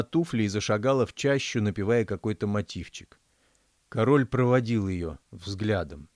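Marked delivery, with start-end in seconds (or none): none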